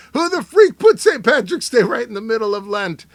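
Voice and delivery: loud, high mock outrage; exasperated outburst. Reading a Saint Patrick's Day meme aloud, exasperated outburst. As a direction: holler it in comic exasperation.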